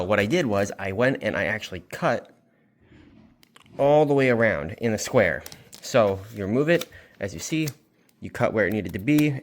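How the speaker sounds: ordinary talk, nothing marked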